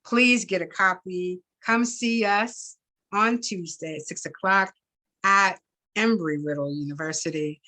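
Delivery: plain reading voice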